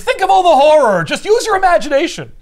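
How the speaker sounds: high, loud, manic urging; hammed-up cheerful menace; cackling boss egging her on; gleeful villain. Villain taunting from above.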